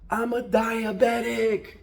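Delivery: mock scream of a barber under pressure; retold scream. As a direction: blurt it out as a desperate cry. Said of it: "and he screams".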